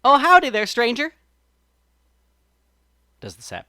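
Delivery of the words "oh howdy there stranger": higher, louder folksy rabbit calling howdy; friendly rabbit greeting. A narrator's reading. Brer Rabbit greets the sap person, loud and friendly. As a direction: hail a stranger loud and friendly, country style. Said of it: "Brer Rabbit says to that little sap person".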